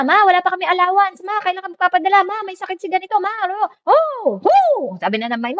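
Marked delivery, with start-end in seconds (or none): 0.0-3.7 high, insistent asking voice; pleading relatives. Retold or imitated. Family members pestering the mother with requests, high pleading. 3.9-5.0 high startled oh; alarmed gasp. Retold or imitated. Overwhelmed mind reacting to demands, high startled cry.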